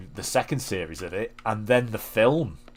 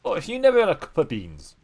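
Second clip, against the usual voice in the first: put-on Geordie accent; 7 semitones higher than usual. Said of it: "I know I'm shit at that accent".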